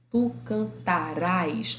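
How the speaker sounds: "cantarás" drawn out, RÁS stressed; stress on final syllable. Shown as a demonstration. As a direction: slowly land on RÁS in "cantarás". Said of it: "Tá no rá".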